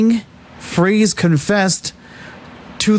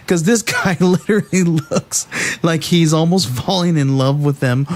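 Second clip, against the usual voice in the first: ordinary talk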